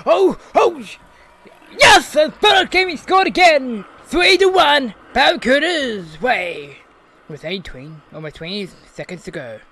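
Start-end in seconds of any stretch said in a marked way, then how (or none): 0.1-6.8 high, loud excited goal call; sports commentator. Acted. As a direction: shout the score like a commentator. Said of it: "my commentating voice is very on point".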